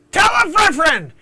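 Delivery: loud high call-out; excited shout. Real, not acted. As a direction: shout it to everyone. Shouting excitedly mid-impression.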